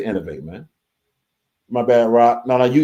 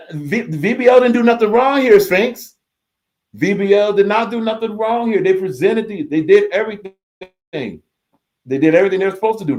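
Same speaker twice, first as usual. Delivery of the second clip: insistent defence; 6 semitones higher than usual. Real, not acted. Host defending VBL to chatter.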